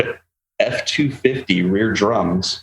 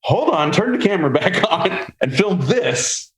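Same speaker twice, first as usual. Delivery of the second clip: high, urgent, with a laugh breaking in; eager high call to the crew; excited; 10 semitones higher than usual. Retold or imitated. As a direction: scramble to get the camera rolling. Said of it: "I'm like".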